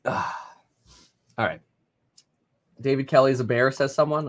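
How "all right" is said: just after a sip of coffee; while sipping a drink. Real, not acted. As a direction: talk right after swallowing. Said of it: "take a sip of cold coffee now".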